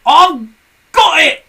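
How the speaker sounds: high, loud eureka cry; Mr Twit crying out. A narrator's reading. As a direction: shout the idea triumphantly. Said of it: "cried Mister Twit".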